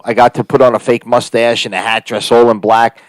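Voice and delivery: ordinary delivery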